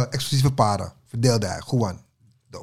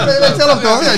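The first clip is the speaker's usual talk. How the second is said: laughing; 9 semitones higher, 9 dB louder than usual. Real, not acted. Laughs recalling dancing nights.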